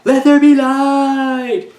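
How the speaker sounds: very high, loud, slow, dragged-out solemn line; milk every word like a wooden prophet; bad acting. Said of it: "He actually delivers the line like that".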